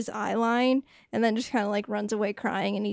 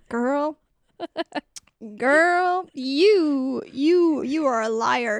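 mock shock; 2 semitones higher, much slower than usual. Real, not acted.

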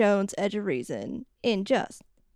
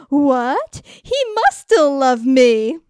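loud, higher mock astonishment; amazed realization; 4 semitones higher, 8 dB louder, slower than usual. Bridget learning from friends what Mark did, hammed-up amazement. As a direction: gasp it out as a hammed-up lovestruck revelation.

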